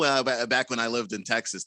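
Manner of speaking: ordinary talk, nothing marked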